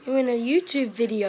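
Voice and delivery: loud call to brother. Real, not acted. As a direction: call it out across the room. Shouting to little brother.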